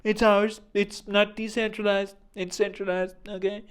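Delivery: hammed-up boastful superpower retort; mock America. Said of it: "America's like".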